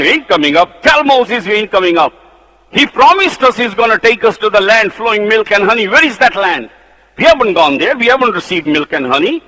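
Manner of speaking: loud, high stubborn refusal; defiant rebels. Acted. Rebels send word they will not come, defiant.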